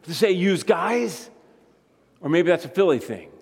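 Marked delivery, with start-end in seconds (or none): 0.3-1.3 "yous guys" said as "yooz guyz", Jersey style. Shown as a demonstration. Preacher showing the congregation the Jersey plural, lightly accented. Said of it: "We're close enough to Jersey to say".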